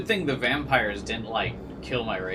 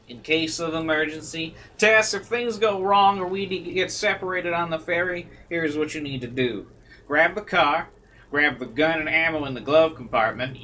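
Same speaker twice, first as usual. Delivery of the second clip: a hint of Southern country twang, louder; country accent; 5 semitones higher, 4 dB louder than usual. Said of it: "going with this country accent".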